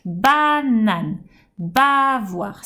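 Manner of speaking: "Banane, bavoir" with each opening ba held long; both words in beats, leaning on ba; stressed first syllable. Shown as a demonstration. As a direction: hit and stretch the ba in "Banane, bavoir". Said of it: "que tu attaques".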